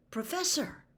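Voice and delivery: high calling out to her; alarmed call. A narrator's reading. Harry calling to his fallen teacher, alarmed.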